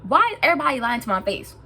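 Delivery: demanding answers, fed up; worked up. Real, not acted. Vlogger venting to viewers, worked up and loud. Upset vent opening the video.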